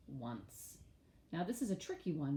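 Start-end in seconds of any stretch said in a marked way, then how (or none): 0.1-0.8 "Once" said softly and low; soft model word. Shown as a demonstration. Teacher softly repeating the sight word. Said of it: "teacher models the word softly".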